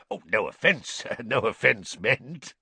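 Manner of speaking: ordinary delivery, nothing marked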